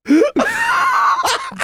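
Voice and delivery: loud high burst of laughter; high shrieking laugh. Real, not acted. Laughing at the Vegas story, loud high laugh. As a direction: cackle up high.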